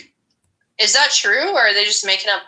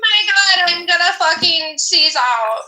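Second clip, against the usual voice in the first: high mock crying shout, much higher voice; mock tantrum; 6 semitones higher than usual. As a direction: ham up a meltdown over attention. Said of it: "This is what I'm talking about".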